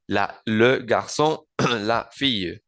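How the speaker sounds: le garçon, la fille, each word drawn out; slow French demo. Shown as a demonstration. Teacher contrasting masculine and feminine articles. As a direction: slowly model le garçon and la fille. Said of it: "en el femenino singular sería la".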